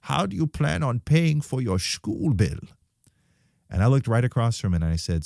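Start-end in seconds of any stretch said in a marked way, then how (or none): 0.0-2.6 put-on slow, higher-pitched German accent; German-accented interviewer, voice lifted; thick German accent. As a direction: imitate a thick German accent, drawing the words out. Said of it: "in his thick German accent".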